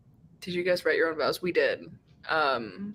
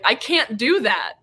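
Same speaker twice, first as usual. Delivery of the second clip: blurted out; 4 semitones higher, 7 dB louder than usual. Retold or imitated. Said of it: "I said it out loud".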